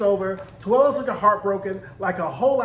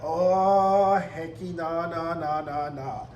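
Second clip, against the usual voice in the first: drawn-out oh, then descending nahs; mock refusal; 4 semitones lower, much slower than usual. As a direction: imitate a flat-out refusal, sinking lower. Voices June's sudden refusal.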